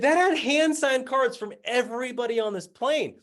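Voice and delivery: high-pitched exasperated outburst; acted-out upset insistence; distraught protest. Distressed retort about discarded cards.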